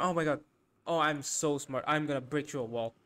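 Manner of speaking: usual speaking voice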